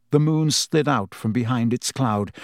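usual speaking voice

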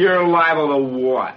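slow, low challenge; mocking, drawn-out comeback; taunting challenge. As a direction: dare him to finish the threat. Taunts back at the threat.

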